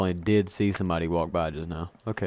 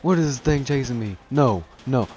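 panicked shouting while fleeing; being chased; 3 semitones higher, 6 dB louder than usual. Real, not acted. Thing chasing him.

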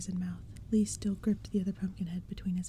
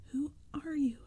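frightened, higher choked cry; 7 semitones higher than usual. Acted. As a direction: force it out through fear. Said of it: "I choked out again".

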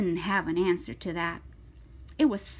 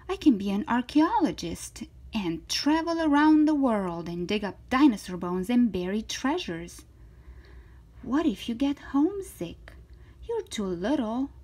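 higher, animated storybook voice; lively, swooping story read; reading to children; 5 semitones higher, 8 dB louder, faster than usual. Acted. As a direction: voice an eager, confident little girl. Reads to children, voicing figures.

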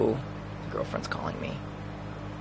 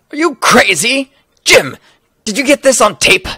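high, loud outburst; excited yelling; 8 semitones higher, 8 dB louder than usual. Acted. Reporter yelling to cameraman Jim, high and loud. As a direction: shout it at the cameraman, pitched up.

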